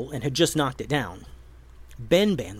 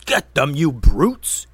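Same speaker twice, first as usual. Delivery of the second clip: loud, angry order; yelled command; 8 dB louder, slower than usual. A narrator's reading. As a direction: bark the order at the brutes. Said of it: "yelled the wizard".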